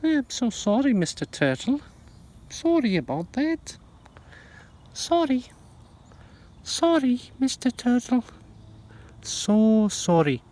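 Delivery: sing-song high voice for a little animal. Real, not acted. Gardener apologizing to the turtle she disturbed, in high baby talk. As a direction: coo a sorry up high, like to a pet.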